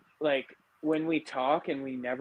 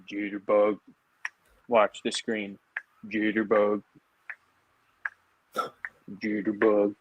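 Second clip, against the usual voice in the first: far from the mic; 5 semitones lower than usual. Real, not acted. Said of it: "you sound like you're right here and you're way down there".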